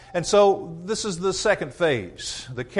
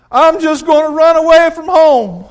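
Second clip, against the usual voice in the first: put-on much higher and louder, petulant kid; sulky child; 11 semitones higher, 13 dB louder than usual. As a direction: whine it like a mad little boy. Preacher voices himself as kid.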